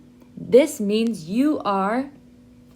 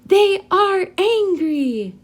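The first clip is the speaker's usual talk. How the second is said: slow, much higher, louder angry chant; angry model phrase; 10 semitones higher, 4 dB louder, much slower than usual. Shown as a demonstration. Teacher leading students to say it angrily. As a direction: lead the class in a slow, angry-toned line. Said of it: "So everyone say".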